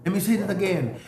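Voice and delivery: ordinary talk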